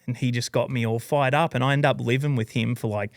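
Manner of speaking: normal talking voice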